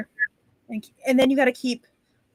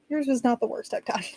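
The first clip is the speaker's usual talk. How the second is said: ordinary delivery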